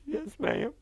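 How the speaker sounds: a choked, retold yes; distressed yes. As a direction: answer while shock takes over. Confirming her husband's ring.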